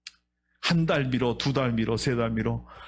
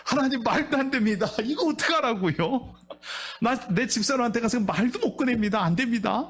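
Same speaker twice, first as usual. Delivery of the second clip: protesting pastor; 5 semitones higher than usual. Acted.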